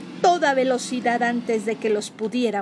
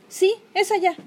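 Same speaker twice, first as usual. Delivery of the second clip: higher, louder eager pointing; excited; 9 semitones higher, 6 dB louder than usual. Acted. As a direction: call it out eagerly. Kenny señala emocionada.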